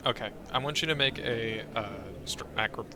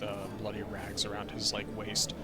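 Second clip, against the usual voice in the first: ordinary delivery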